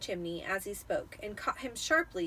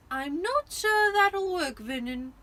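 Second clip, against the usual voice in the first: high, slow doubtful wife; Aunt Petunia voice; 8 semitones higher, 7 dB louder, slower than usual. A narrator's reading. Petunia doubting her husband's plan, slow and fretful. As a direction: fret it like an anxious housewife.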